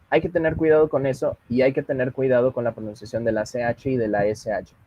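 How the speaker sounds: ordinary talk, nothing marked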